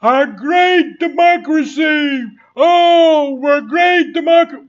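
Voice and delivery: high, loud mocking shout of their slogan; mocking scream. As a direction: shriek it like a smug pundit. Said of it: "they screaming".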